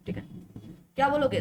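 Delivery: ordinary talk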